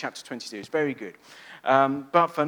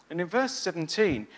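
ordinary talk

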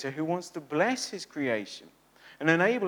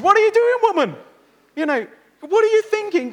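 much louder, much higher shocked husband yelling; alarmed shout; 11 dB louder than usual. Pretend. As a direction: stop her loudly, in alarm.

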